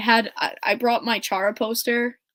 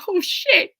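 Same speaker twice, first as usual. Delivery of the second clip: high, squeaky mock panic; flustered oh shit; 10 semitones higher, 6 dB louder than usual. Telling the fan story, a squeaky flustered gasp. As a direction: caught off guard and embarrassed.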